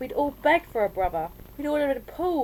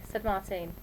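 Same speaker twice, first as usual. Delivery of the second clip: plain reading voice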